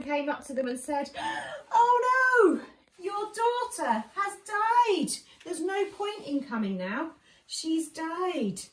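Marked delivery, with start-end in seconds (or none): 1.1-1.7 a sharp, high startled gasp; sudden alarmed intake of breath; shocked gasp. Acted. Messenger bursts in with news. 1.7-8.6 much higher and louder, distressed cry; a frantic messenger breaking awful news; alarmed bad news. Messenger brings terrible news.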